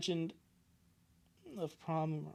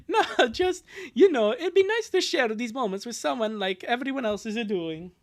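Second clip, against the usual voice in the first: whiny lament; 7 semitones higher, 3 dB louder than usual. Acted. Dubbing lonely character.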